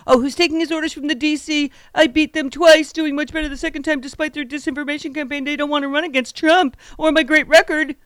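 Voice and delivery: mocking high-pitched politician's voice; high, put-on caricature of a ranting politician; Trump. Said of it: "real voice is actually that high pitched".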